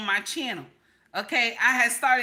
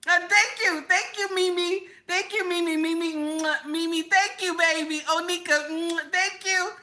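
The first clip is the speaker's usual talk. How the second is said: sweet thanks; 6 semitones higher than usual. Real, not acted. Thanking viewers sweetly, kisses.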